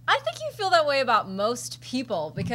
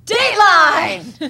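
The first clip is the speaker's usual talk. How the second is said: loud, slow drawn-out shout; shouted announcement; 6 semitones higher, 14 dB louder, much slower than usual. Pretend. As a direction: bellow it like a show bumper. Shouted segment bumper.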